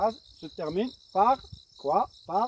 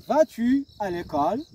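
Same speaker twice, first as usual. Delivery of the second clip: Vas-tu à l'école? rising toward l'école; rising question; 4 semitones higher, 5 dB louder than usual. Shown as a demonstration. Teacher models the inverted question, rising. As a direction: lift the end as a question. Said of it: "on a changé l'intonation".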